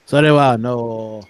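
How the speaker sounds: low, slow Japanese; male-sounding Japanese. Shown as a demonstration. Showing off full Japanese to friends, low and measured. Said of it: "so male in Japanese".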